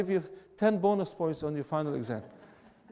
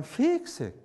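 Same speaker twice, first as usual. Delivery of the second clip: slow, smooth Southern "fixing"; drawled Southern fixing; Southern accent; 8 semitones higher than usual. Shown as a demonstration. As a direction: stretch the first vowel of fixing Southern-style. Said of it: "more smooth".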